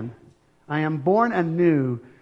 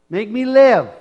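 much louder cry to God; fervent plea; 5 semitones higher, 11 dB louder, slower than usual. Shown as a demonstration. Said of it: "just ask him and say".

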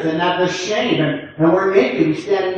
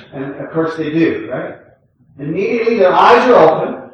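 ordinary talk

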